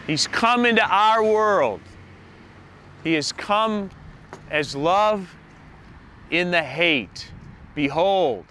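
loud, ringing preacher's proclamation; full-force preaching. Acted. Pastor opening his Christmas sermon, loud full-force preaching. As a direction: proclaim it to the whole church.

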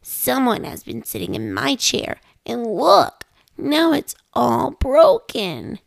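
tiny bear voice, high and slow on all gone; Baby Bear voice. A narrator's reading. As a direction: cry out like an upset little cub. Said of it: "Baby Bear said".

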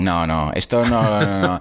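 ordinary talk, nothing marked

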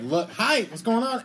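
impatient drive-thru order. Retold or imitated. Co-host showing his friend how to order, impatient. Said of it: "just be like".

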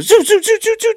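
quick high zipping noises; imitated pit-crew zooms. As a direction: mimic whizzing pit-stop tools. Imitating pit crew changing tires.

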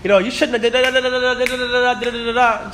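high, monotone rattle of scolding; mock chewing out. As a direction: imitate yourself nagging on and on. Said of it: "like, chewing her out".

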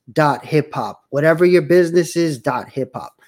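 ordinary talk, nothing marked

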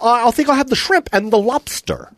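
a light comic Tom Green ordering; Tom Green impression. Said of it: "That's exactly what he sounds like".

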